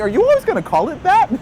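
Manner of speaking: teasing disbelief at mom's word; exasperated. Retold or imitated. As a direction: protest in disbelief. Exasperated retort to mom.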